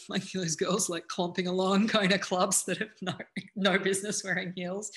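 talking through real laughter; amused, laughing as the words come out. Real, not acted. Coach joking about girls clomping to clubs in heels, laughing.

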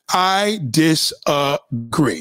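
very slow, each syllable separated; deliberate disagreement. Real, not acted. Daring Nate to argue, very slow deliberate objection. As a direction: spell out the objection syllable by syllable, slowly.

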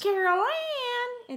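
high, slow, drawn-out ghostly call; Poltergeist voice. Retold or imitated. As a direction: stretch the name out like a haunted child calling. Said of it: "It kinda sounds like the Poltergeist voice".

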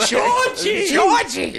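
very high shrieking call; Estelle Costanza yell. Retold or imitated. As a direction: scream for your son. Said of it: "Estelle Costanza".

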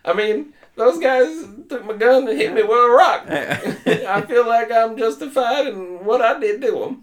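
very high, pixie-like hillbilly voice; imitated high-pitched hillbilly character; funny hillbilly voice. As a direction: do a squeaky, comical hillbilly impression. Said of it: "He spoke with this funny hillbilly voice".

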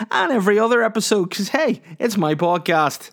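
high, bright, cheesy radio host intro. Pretend. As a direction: ham up the radio presenter welcome. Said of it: "that was my radio D J voice".

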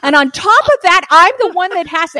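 building from muttered grumble to high, loud furious rant; resentful inner rant. Retold or imitated. Wife ranting about husband in her head, building to furious yell. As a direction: let the resentment boil over into yelling. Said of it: "The voice just goes on and on".

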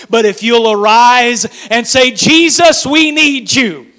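loud, rising preacher's cry; preaching at full force. Acted. Preacher pleading with the church for Jesus. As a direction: build the sermon to a climax.

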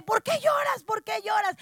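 urgent questioning. Retold or imitated. Sister asks why her little sister is crying, high urgent questioning. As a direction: cry out the question, imitating a worried sister.

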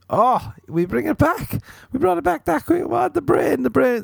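high excited squeal; delighted squeal at his own pun. Real, not acted. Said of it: "See, this is".

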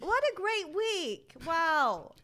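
high, loud, slow gushing praise; exaggerated mock enthusiasm. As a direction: stretch it out, oversell the praise. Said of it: "I'm proud".

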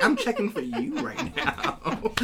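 playful giggling come-on; laughing. Real, not acted. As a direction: tease through giggles. Laughing flirt at guest.

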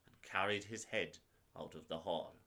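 usual speaking voice

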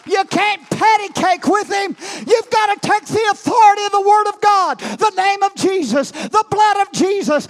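high, loud preaching shout; fiery preaching. Real, not acted. Preacher rousing the congregation, shouting. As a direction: thunder it from the pulpit.

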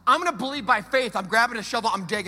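loud, driving sermon climax; full-force preaching. Acted. Pastor proclaims the resurrection to the congregation, loud and driving. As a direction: preach it to the back row.

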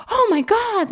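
high, loud retold squeal of a thrilled kid; starstruck excitement. Second grader hears [name] is coming, thrilled. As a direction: burst out high and loud, imitating an ecstatic child.

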